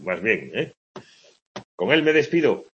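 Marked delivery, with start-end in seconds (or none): 1.8-2.7 letter reading voice. Shown as a demonstration. Teacher reading a student's letter aloud, a touch raised. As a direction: read it out as the letter's sign-off. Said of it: "una pregunta para esta última frase".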